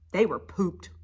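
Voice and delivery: ordinary delivery, nothing marked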